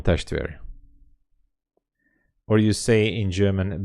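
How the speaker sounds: ordinary delivery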